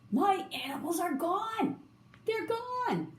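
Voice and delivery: high, distressed wail; alarmed cry. A narrator's reading. Zookeeper finding his animals missing, in high alarm. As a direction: climb high in dismay. Zookeeper discovers empty cages.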